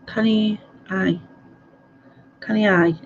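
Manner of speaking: "canny, aye" in light Scots; easy Glasgow "canny, aye"; Glaswegian accent. Shown as a demonstration. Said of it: "A Glaswegian would say canny".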